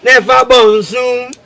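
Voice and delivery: loud, high, slow commands; loud slow instruction. Acted. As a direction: spell it out loudly and slowly, mocking. Mock instructions in comic argument.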